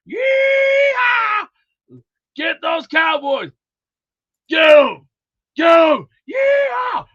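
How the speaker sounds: high, loud rodeo hollering; rally the boys with yeehaws and whoops; cowboy whooping. Pretend. Rousing folks for Tennessee, whooping cowboy shouts.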